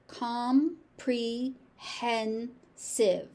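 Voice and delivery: comprehensive very slowly, all four syllables. Shown as a demonstration. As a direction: draw comprehensive out, every syllable clear. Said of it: "pronouncing all of the ... syllables".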